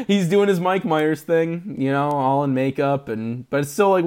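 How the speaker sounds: ordinary talk, nothing marked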